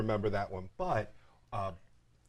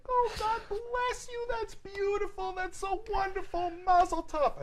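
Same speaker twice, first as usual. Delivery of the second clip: very high, slow, gushing aunt impression; overjoyed aunt, hammed-up and high; Aunt Rhoda. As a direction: heap on blessings in a drawn-out high voice. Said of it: "She's like".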